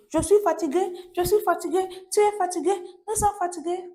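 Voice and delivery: high, singsong list of stock phrases; mocking rote recital. Teacher mimicking learners' stock phrases, high mocking recital. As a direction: rattle them off high like a tired drill.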